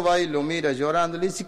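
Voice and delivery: ordinary delivery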